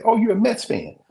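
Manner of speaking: high, loud mock assumption; mock know-it-all. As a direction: jump in sure of yourself, way up high. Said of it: "people say".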